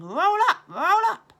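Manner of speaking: loud, high market barker cry; game show host shout. Acted. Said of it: "by shouting".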